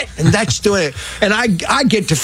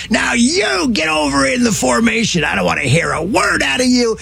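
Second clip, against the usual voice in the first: coach shouting; 5 semitones higher than usual. Retold or imitated. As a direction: yell the command at the late kid. Coach yells order at late boy.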